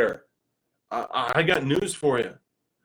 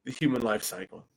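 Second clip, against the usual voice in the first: normal talking voice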